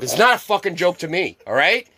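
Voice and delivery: loud, high mock-angry retort; feigned anger. Prankster refusing his buddy's excuse, in mock anger. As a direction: snap back as if truly offended.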